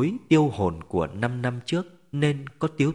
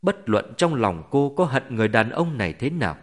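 usual speaking voice

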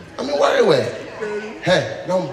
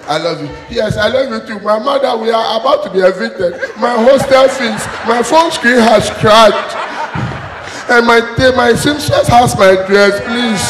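whiny money demands; 2 semitones higher, 5 dB louder than usual. Acted. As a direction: pile on the demands, louder and louder. Girlfriend listing money needs.

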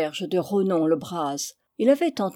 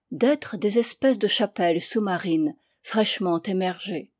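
ordinary delivery, nothing marked

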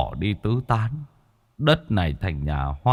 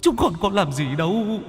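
high, thin wailing plea; a high ghostly whimper for mercy; pleading ghost; 7 semitones higher than usual. Acted. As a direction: beg like a frightened lost soul.

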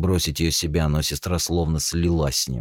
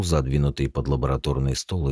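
ordinary delivery, nothing marked

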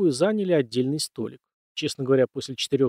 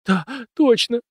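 high, loud sobbing cry; 10 semitones higher, 5 dB louder, slower than usual. A narrator's reading. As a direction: cry it out through tears. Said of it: "Рыдания в трубке усилились".

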